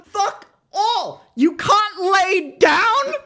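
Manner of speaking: high, incredulous shout; comic ranting disbelief; exasperated outrage. Real, not acted. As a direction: draw it out, rising in disbelief. Ranting about the absurd rule.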